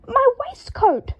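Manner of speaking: slow, high, loud screech; slow, high horrified screech. A narrator's reading. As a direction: screech at the ruined waistcoat. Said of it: "screeched Draco".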